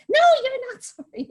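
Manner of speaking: guard refusing the apology, high loud British; indignant British old lady. Retold or imitated. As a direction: snap back like an outraged tiny guard. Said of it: "in a really heavy British accent".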